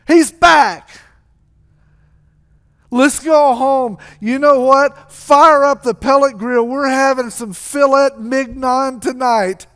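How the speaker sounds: high, loud, jubilant father calling out; excited acted hollering, drawn out; yelling to employees. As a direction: shout orders across the yard, elated. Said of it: "yells at the employees".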